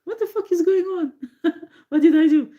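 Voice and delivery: giggling imitation of the baffled man; his bafflement. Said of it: "It's like".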